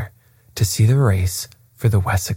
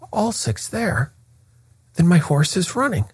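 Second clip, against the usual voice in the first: agitated cry; 10 semitones higher than usual. A narrator's reading. Colonel learning that his horse is running, high agitated cry. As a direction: pitch leaps up in agitated disbelief. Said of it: "cried the colonel in great agitation".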